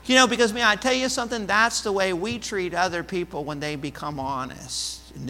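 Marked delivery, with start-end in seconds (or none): none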